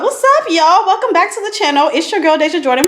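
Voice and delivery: high, fast channel intro; hyped intro. Shown as a demonstration. Opening her channel intro, high and fast with energy. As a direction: pump up the greeting. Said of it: "let me do the intro".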